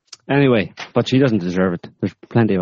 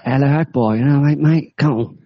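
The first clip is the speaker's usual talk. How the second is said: mock cockney jihadi; 7 semitones higher, 3 dB louder than usual.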